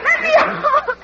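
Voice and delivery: very high, panicked plea; frantic cry. Acted. Woman held underwater begs frantically to be let out. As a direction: squeal to be let up. Struggling underwater, crying for help.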